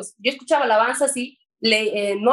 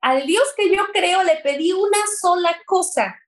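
distraught retelling; 7 semitones higher than usual. Retold or imitated. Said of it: "Entré en un shock porque dije".

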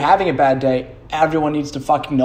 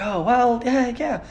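high mock voice asking about a gift; hammed-up eager giver fishing for thanks; lightly voiced eager gift-giver; imitating stepmom's partner; 9 semitones higher than usual. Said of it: "he's like".

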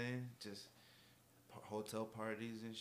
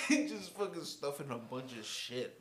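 laughing; 5 semitones higher, 8 dB louder than usual. Real, not acted. Laughing at packing while blacked out.

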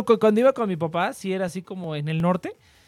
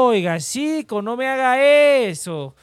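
put-on drawn-out, higher, louder provincial Colombian accent; slurred provincial Colombian; 4 semitones higher, 4 dB louder, much slower than usual. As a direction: drag the vowels like rural Colombians. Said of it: "Barrido, creo que le llaman".

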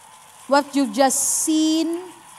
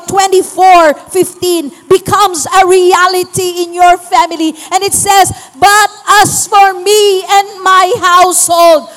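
louder, rousing preacher's call; preaching at full force; 4 semitones higher, 8 dB louder than usual. Acted. Pastor urging the church to reach their families, preaching hard. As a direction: drive it home to the congregation, pushing each line. Pastor exhorts congregation to action.

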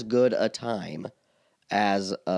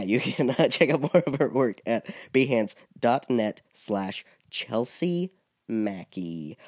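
ordinary delivery

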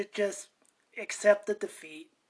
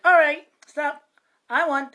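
put-on loud, high bratty kid claiming the win; mock a child shouting he won; whiny kid; 7 semitones higher, 11 dB louder than usual. Mocking a kid who quits the game while ahead, loud and high. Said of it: "the kid was like".

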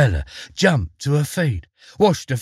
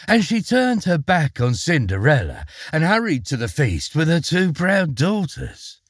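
ordinary delivery, nothing marked

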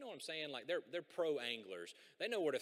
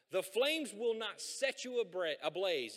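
loud, raised preaching read of the verse; scripture reading; 4 semitones higher, 6 dB louder, slower than usual. Acted. Pastor proclaiming God's promise to the congregation, loud preaching read. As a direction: proclaim the promise slowly and boldly.

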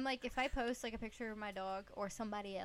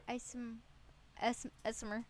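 ordinary talk, nothing marked

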